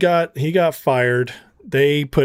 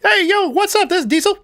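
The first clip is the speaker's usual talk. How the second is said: much higher, thin squeaky voice; mocking a tiny tough guy's squeaky phone greeting; squeaky little voice; faster than usual. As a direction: play the tough guy whose voice squeaks way up high. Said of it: "I hear".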